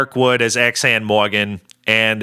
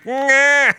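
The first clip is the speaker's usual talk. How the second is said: high "nyaah" in place of a hiss; imitated character sound. Host shows co-host what he does instead of hissing, high comic wail. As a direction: squeal it out like a comic cat-man. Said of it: "but he just goes".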